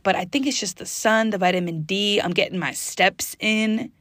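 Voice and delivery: normal talking voice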